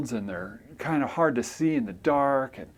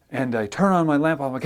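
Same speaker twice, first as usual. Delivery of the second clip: normal talking voice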